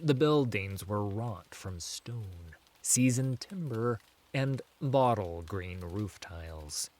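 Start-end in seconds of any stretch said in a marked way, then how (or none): none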